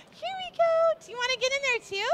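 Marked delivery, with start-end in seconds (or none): 0.1-1.0 high lilting here we go; bright cooing here we go. Real, not acted. Coaxing baby for shot.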